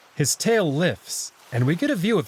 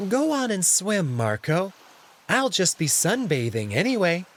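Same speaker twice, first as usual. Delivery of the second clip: higher, nervous cat voice; flustered Alex; 5 semitones higher than usual. Acted. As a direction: hurry the politeness, embarrassed.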